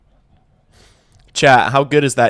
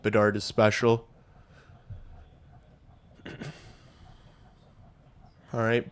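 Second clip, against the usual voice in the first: ordinary talk, nothing marked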